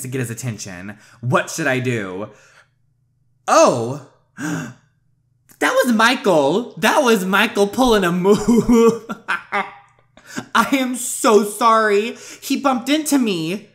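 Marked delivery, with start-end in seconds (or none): 3.5-9.3 higher, louder, slowed-down gasp of realization; drawn out and delighted, catching on to the trick; surprised laughing realization. Real, not acted. Realizing Michael caused the bump. 10.5-13.7 higher, louder and slowed, a mock-sincere sorry; hammed-up apology. Over-the-top apology to the prince.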